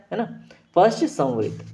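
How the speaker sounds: normal talking voice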